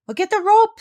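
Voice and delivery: high, loud bossy call; urgent command. Acted. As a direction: order the rope fast. Parrot takes charge in wreck.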